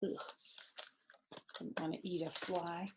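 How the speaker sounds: ordinary delivery, nothing marked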